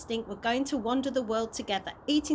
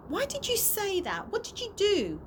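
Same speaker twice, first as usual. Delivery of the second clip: put-on higher pitched accusing girl; a girl turning on a friend who let her down; angry demand; 10 semitones higher than usual. A narrator's reading. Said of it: "she asked angrily".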